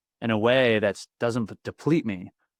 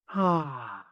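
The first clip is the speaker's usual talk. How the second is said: higher sighing exhale, falling away; deep breath out; 4 semitones higher, 4 dB quieter than usual. Retold or imitated. Man retelling her breathing instruction, deep breath out. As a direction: let a deep breath fall out on "ah". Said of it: "take a deep breath".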